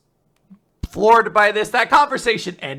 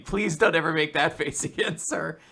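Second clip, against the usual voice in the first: ordinary talk, nothing marked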